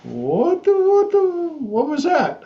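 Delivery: shocked, squeaky high what-the; mock bewildered parents, voice jumping up high; baffled family. Family reacting to the crying intern, baffled high voice. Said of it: "the family's like".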